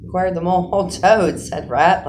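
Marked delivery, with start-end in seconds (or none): 0.7-1.4 laughing out the words; hearty laugh. A narrator's reading. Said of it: "laughing heartily".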